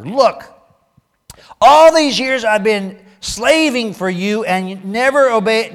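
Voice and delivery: loud, high, aggrieved complaint; indignant older son. Acted. Older son protesting to his father, loud and aggrieved. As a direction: read it like a son who feels cheated.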